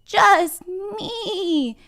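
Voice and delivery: high, stretched-out goat voice; bigger billy goat. Acted. Bigger goat answers the troll, high drawn-out goat voice. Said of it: "said the bigger billy goat gruff".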